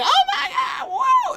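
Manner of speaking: high laughing shout of excitement; hyped shout. Retold or imitated. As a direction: squeal with mock delight. Imitating fans' hyped reaction.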